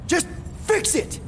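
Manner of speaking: high, frustrated outburst; angry yelling. Acted. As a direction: snap the order, out of patience. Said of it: "Here to yell at me some more?".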